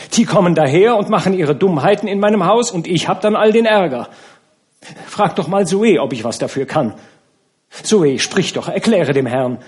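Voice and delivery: high, loud rushed protest; agitated outpouring. A narrator's reading. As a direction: pour out the defence breathlessly. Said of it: "überquellende Flut von Worten".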